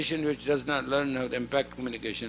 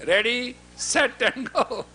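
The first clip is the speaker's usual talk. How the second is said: ready, set, and go stretched slowly through a chuckle; slowly and laughingly say ready, set, and go; drawn-out count; 9 semitones higher, slower than usual. Shown as a demonstration. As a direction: tease out the proper countdown. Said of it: "I didn't say".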